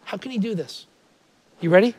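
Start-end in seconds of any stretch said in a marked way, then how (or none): none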